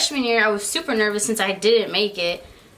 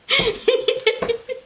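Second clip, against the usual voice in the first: high, bubbly burst of laughter; giggle. Real, not acted. Laughing at her own shoe confession, high giggle. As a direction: let a real giggle spill out.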